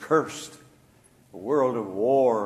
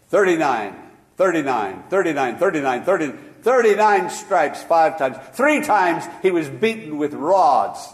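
"thirty-nine" repeated louder each time; hammering repetition; 4 semitones higher, 7 dB louder than usual. Acted. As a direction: pound out the number like a sermon refrain.

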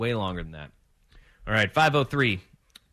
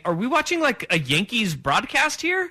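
higher, incredulous question; 7 semitones higher than usual. Retold or imitated. Host mocking the Yankees broadcast, incredulous. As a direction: ask it in mock disbelief at the broadcast. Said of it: "I was looking around saying".

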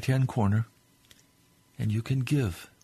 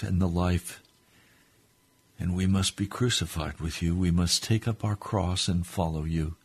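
fervent prayer; 2 semitones higher, 3 dB louder than usual. Real, not acted. Preacher praying to the Lord for his listeners, fervent prayer. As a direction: pray earnestly, hushed then rising to a fervent cry.

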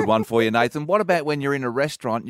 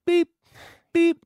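machine beep; 4 dB louder than usual. Retold or imitated. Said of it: "The machine just goes".

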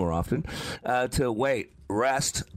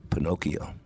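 voice in his head; 6 semitones lower than usual. Retold or imitated. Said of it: "you know what I heard".

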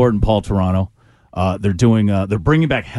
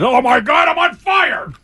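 high yelp, I'm on fire; shouting; slower than usual. Real, not acted. Hot pepper kicking in.

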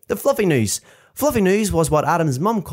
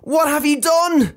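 high, slow, outraged cry; screamed accusation; 10 semitones higher than usual. Acted. Furious mum calling up to her son, high screamed accusation. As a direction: demand it in a high, drawn-out mock scream. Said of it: "An ear-shredding scream erupted downstairs".

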